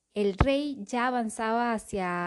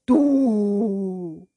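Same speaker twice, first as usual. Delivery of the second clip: booming angry bellow; 10 dB louder than usual. Acted. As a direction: bellow it like an enraged king. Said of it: "bramó, aún más fuerte que Maldeocus".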